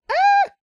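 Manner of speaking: sharp high shriek; startled scream. Real, not acted. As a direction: yelp like you got jumped. Startled by jump scare.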